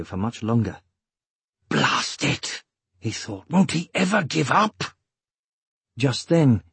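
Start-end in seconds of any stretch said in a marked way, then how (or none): 1.7-2.6 low, rough outburst under the breath; frustrated inner oath; exasperated curse. A narrator's reading. Exasperated thought in narrated fight. 3.5-4.8 higher-pitched, incredulous inner complaint; exasperated inner question. A narrator's reading. Roran's exasperated thought mid-fight.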